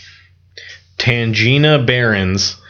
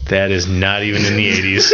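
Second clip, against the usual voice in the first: normal talking voice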